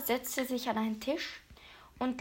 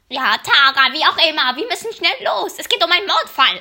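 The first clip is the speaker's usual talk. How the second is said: urgent; 4 semitones higher, 11 dB louder, faster than usual. Acted. Urgent rush to leave.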